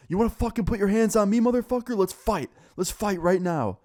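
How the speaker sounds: high-pitched, worked-up callout to fight; pissed-off challenge. Retold or imitated. Student challenging teacher to a fight, acted pissed-off outburst. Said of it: "And he was pissed".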